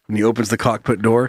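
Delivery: normal talking voice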